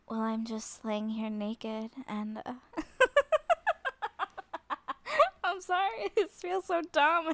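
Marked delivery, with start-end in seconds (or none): 2.8-7.3 high, loud giggle fit; embarrassed giggling. Real, not acted. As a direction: crack up in embarrassment. Embarrassed giggle while trying sexy talk.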